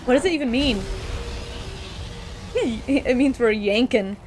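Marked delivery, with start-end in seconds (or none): 0.9-2.8 silent wheezing laugh; real airless laugh; breathless laugh. Real, not acted. Laughing off-mic at her friend's joke.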